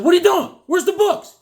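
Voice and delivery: loud, high, shocked yelling at the shop guy; retold shout. Owner yelling at the shop guy who took the books down. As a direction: demand where the books went, loud and high. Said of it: "I said".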